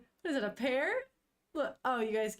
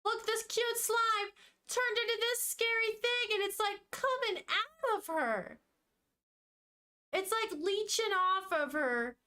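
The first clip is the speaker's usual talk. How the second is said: much higher, urgent alarmed pointing-out; 10 semitones higher than usual. Real, not acted. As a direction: sound freaked out at the screen. Alarmed at the show.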